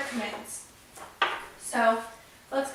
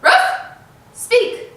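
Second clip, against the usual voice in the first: loud, much higher yappy bark then command; high dog bark; 9 semitones higher, 9 dB louder than usual. Shown as a demonstration. Showing viewers the bark, high and loud. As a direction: yap it out high. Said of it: "You say".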